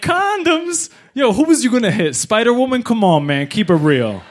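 high-pitched mock disbelief, easing down; hammed-up cellmate scoffing; incredulous cellmates. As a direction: rib him in a high, disbelieving voice. Cellmates mock his condom theft.

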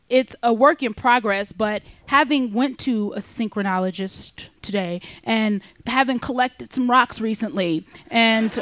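ordinary talk, nothing marked

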